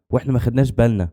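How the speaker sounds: usual speaking voice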